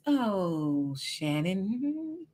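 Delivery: soft, drawn-out melodramatic swoon; fifties soap opera. Acted. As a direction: sigh the name like an old soap heroine. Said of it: "soap operas from the fifties".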